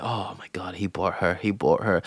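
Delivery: low, quiet mock mutter; mumbling imitation. Imitating Jacob grumbling about the new arrival, mumbling. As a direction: mumble it under your breath, imitating him. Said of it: "hear him mumbling like".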